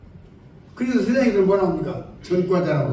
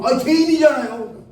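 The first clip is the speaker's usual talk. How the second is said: put-on higher, slow insistent old lady's answer; stubborn elder's reply; 5 semitones higher, 4 dB louder than usual. Preacher voicing the elder's stubborn reply, slow and higher. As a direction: drag it out like she's stating the obvious.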